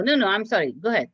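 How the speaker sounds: ordinary talk, nothing marked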